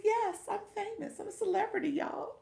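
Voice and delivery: high, playful self-important boast; mock diva. Retelling her reply to the stranger, high mock boast. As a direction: ham up being famous. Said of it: "I said".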